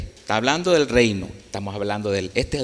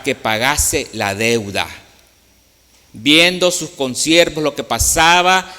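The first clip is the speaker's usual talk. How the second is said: scripture reading; 3 semitones higher, 5 dB louder than usual. Acted. Preacher reading the parable aloud, louder and proclaiming. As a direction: proclaim the verse to the congregation.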